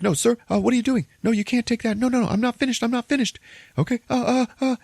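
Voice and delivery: very high, frantic pleading; hammed-up panicked protest; freaking out. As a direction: imitate a man in a childlike meltdown. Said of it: "he starts freaking out".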